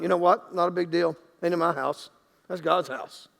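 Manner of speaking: low, casual shrug of a voice, dropping away; mock nonchalance. Congregant shrugging off their burning house, mock nonchalant. Imagined unbothered reaction to house fire.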